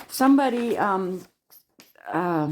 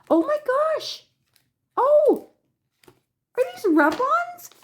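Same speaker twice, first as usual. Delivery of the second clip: high, loud excited squeal; thrilled high-pitched outburst; astonished delight; 5 dB louder than usual. Real, not acted. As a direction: exclaim high in real disbelief. Discovers the surprise gift.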